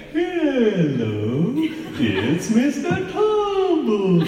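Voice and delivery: high, stretched sing-song clown greeting; a child's squeaky kids-TV clown hello; Mister Tumble clown voice. Retold or imitated. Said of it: "a bit of a strange sort of clown voice".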